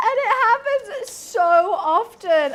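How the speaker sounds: loud, slow, exasperated complaint. Real, not acted. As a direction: lean hard into the frustration. Exasperated about insult.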